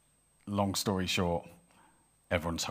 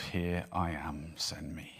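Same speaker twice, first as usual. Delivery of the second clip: slow and soft, sinking to a whisper; hushed prayer; 3 semitones lower, 8 dB quieter, slower than usual. Acted. Young lad starting his day with God, hushed prayer. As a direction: offer it up quietly, fading to breath.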